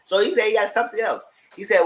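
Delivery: ordinary delivery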